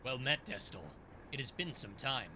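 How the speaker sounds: low, solemn teacher voice; stern old mentor. Acted. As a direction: speak as a grave celestial master.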